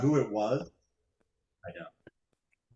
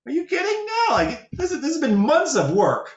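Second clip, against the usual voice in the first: mock indignant protest; 4 dB louder than usual. His indignant reply retold.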